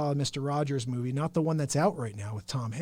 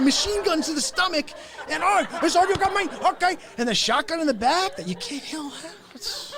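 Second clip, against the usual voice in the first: much higher teasing voice; mocking Tony; 11 semitones higher, 4 dB louder than usual. Mocking Tony about the shotgun, high. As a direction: tease him in a squeaky voice. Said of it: "I'm like".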